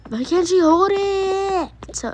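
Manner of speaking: slow whimpering complaint; whiny cry. Real, not acted. Kid whining to mom about her sister. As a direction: whine it out close to tears.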